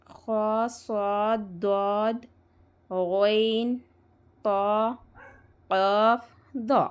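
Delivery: each of ख, सॉद, दॉद, गैन, तो, कफ, दो held long with a full, heavy sound; full heavy letters. Shown as a demonstration. Teacher listing the seven musta'liya letters to students, full and heavy. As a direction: recite ख, सॉद, दॉद, गैन, तो, कफ, दो one by one, thick and rounded. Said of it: "हर हाल में और हमेशा पुर पढ़ना है".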